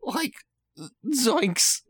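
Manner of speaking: high, fading zoinks as he falls; slowly collapsing Shaggy cry; dying Shaggy. Acted. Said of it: "That's the sound of Shaggy dying".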